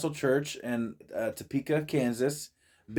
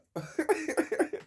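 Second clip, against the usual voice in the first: laughing; 5 dB quieter than usual. Real, not acted.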